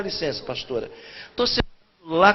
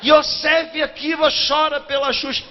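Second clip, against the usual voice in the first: mock headline; 5 semitones higher, slower than usual. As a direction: announce the scandal headline. (manchete zombeteira)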